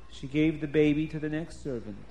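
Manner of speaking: ordinary delivery, nothing marked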